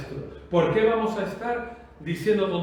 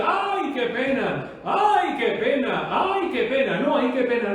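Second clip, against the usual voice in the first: mock wailing; 4 semitones higher than usual. Preacher mimicking people who regret too late, mock wailing. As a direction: wail "ay" in exaggerated pity. Mocking the people's later lament.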